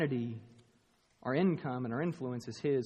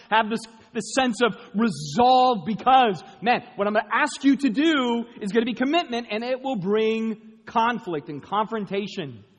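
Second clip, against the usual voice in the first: loud preaching voice; full-force sermon delivery; 6 semitones higher, 9 dB louder than usual. Acted. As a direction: drive God's charge home. Preaching God's charge at full force.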